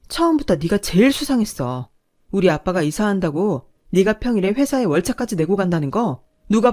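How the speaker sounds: loud, furious accusation, rising; angry yelling. Acted. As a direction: tear into him, demanding the truth. 남편에게 화내며 추궁하는 장면. (화가 나서 소리치며 따지기)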